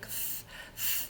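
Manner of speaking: ф, ф hissed softly like a little snake, tongue between teeth; voiceless th hiss. Shown as a demonstration. Teacher shows students the voiceless th, soft hissing th. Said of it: "так пошипіти трошки".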